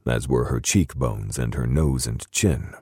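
ordinary delivery, nothing marked

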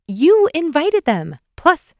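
high, loud, drawn-out comeback; playful protest. Acted. Nicole argues with Carl, in playful protest. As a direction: throw it back at them, high and slow. Playful quarrel over party guests.

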